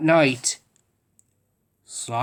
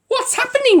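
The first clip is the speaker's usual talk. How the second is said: high, loud alarmed question; startled as the car stops; cried out; 5 dB louder than usual. A narrator's reading. Noddy stuck in toffee, crying out in alarm. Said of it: "he cried".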